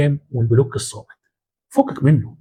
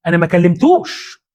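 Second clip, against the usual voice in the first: pitched-up fake innocence, slowing on the denial; mock innocent victim; 6 semitones higher than usual. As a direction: play the wronged innocent to the crowd. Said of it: "يقول لهم".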